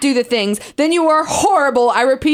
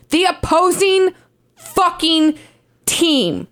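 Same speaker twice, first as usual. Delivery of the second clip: each word split by pauses, opposing pushed up; 4 semitones higher, much slower than usual. Acted. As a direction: hammer it out word by word. Spaced for emphasis.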